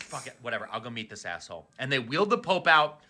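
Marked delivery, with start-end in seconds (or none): 0.0-1.7 low, much softer and fast; grumbled under the breath; resigned mutter. Retold or imitated. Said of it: "the Pope was like".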